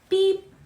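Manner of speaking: high mock scanner beep. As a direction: chirp beep like a till. Cashier scanning an item aloud.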